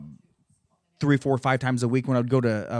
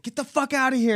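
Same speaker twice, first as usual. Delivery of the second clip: high-pitched mock order to leave; imitated angry attacker; 12 semitones higher than usual. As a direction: mimic him chasing you off, pitched up. Mimicking the attacker chasing him off.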